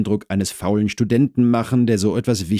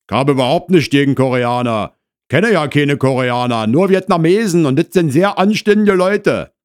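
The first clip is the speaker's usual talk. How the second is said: loud, raised Berlin worker; indignant husband; 8 semitones higher, 8 dB louder than usual. Acted. Husband defends his view of Koreans, loudly indignant. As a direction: defend yourself loudly, rising higher.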